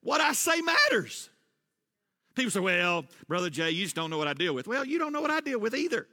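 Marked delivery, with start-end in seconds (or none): none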